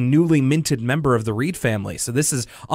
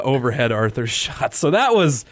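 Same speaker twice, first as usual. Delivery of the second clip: ordinary talk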